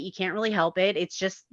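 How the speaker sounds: ordinary delivery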